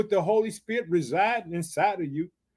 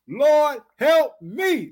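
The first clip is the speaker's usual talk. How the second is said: high, forceful call to repeat; crying out; 6 semitones higher, 5 dB louder, much slower than usual. Shown as a demonstration. Preacher leading the congregation in the woman's plea, crying out. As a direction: lead the church in a high, loud plea. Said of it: "Say it with me".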